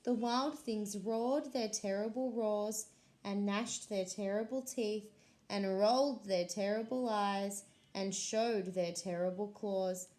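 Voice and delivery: ordinary delivery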